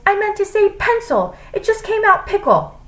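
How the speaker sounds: high-pitched exasperated snap; snapped. A narrator's reading. Flustered teacher explaining her slip of the tongue, snapped. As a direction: snap an irritated self-correction. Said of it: "snapped Missus Jules".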